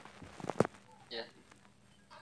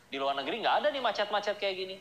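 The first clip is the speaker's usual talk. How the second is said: mock complainer; 5 semitones higher, faster than usual. Mocking complainer about Bogor traffic.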